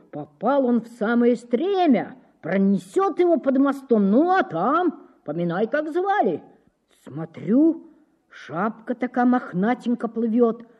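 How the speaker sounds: a common lad proudly telling his rescue; breathless bragging workman, voice up high; eager factory worker. Acted. Factory worker tells how he saved the boy.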